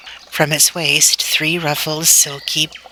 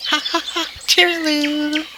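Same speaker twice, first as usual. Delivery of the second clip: very high, chirped bird laugh; 14 semitones higher than usual. A narrator's reading. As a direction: laugh it lightly like a trilling songbird. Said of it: "And another laughed".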